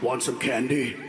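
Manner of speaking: very low creepy growl with a laugh; creepy Batman voice. Retold or imitated. Comedian mocking Batman as a stranger luring kids, low growl. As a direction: lure them like a creep in an alley. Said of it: "He even sounds creepy".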